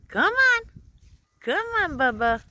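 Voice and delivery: high, loud calling the dog. Real, not acted. Calling dog, much higher.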